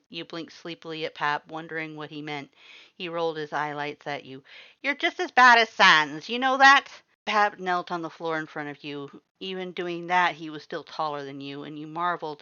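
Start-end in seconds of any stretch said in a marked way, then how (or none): 4.8-6.9 high, loud exasperated scolding; exasperated Papyrus. A narrator's reading. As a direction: scold with a huffy boom.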